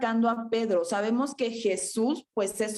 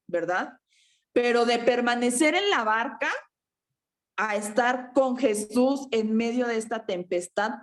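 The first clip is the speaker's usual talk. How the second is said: usual speaking voice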